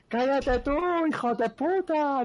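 high, drawn-out insult hurled across balconies; mock shouted retort. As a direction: imitate yelling back at a distant heckler. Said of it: "y le dice". (réplica a voces, imitada, aguda y alargada)

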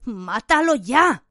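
slow, insistent command; urging. Acted. Inner voice pressing Harry to kill, insistent. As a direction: drive him on, slowly and firmly.

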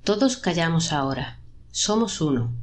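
usual speaking voice

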